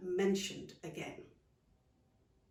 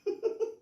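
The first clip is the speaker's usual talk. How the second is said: hearty laugh. Acted. Aunt laughing.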